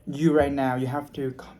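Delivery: normal talking voice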